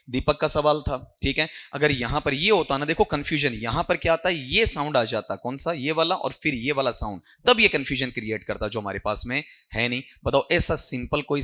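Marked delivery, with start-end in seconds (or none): none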